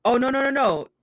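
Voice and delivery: no no no, come on, absolutely not; emphatic denial. Real, not acted. Emphatic denial about the gas.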